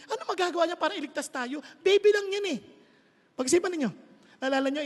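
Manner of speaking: ordinary talk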